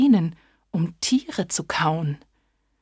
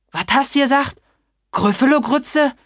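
startled monster; 6 semitones higher, 5 dB louder than usual. Acted. Monster shocked at being food.

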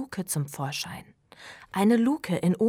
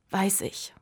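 plain reading voice

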